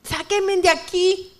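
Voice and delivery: high, drawn-out begging call; imploring, stretched-out cry; reenacted desperate plea. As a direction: plead slowly to be let out. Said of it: "empecé a gritar desesperada".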